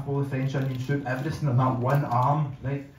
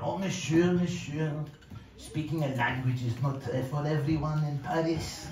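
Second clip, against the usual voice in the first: mock French accent, softer at the end; French waiter accent; 5 dB quieter than usual. French waiter corrects the struggling diner, mock French accent. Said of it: "this French accent".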